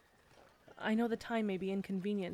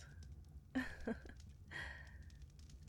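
soft giggle; 4 semitones higher, 11 dB quieter than usual. Acted. Shy laugh.

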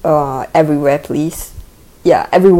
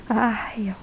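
whispered sigh; 4 semitones higher, 8 dB quieter than usual. Real, not acted. Relaxing under massage.

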